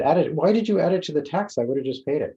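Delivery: indignant retort. Retold or imitated. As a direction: push back hard at the clerk in disbelief. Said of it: "I was getting really upset".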